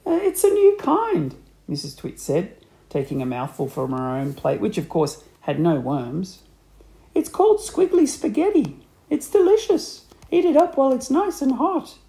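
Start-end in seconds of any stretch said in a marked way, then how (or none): 0.0-1.4 very high, loud character voice; high-pitched wife. A narrator's reading. Wife voiced, covering her trick. 7.1-12.0 high, loud, insistent sales pitch; high-pitched coaxing wife. A narrator's reading. Wife coaxing husband to eat worms.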